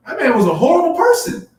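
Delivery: shouting the hard truth about him; loud outburst. Real, not acted. Condemning the dead thief, shouted loud. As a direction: pitch jumps way up, almost shouting. Heated point in discussion.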